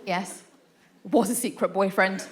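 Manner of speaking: ordinary talk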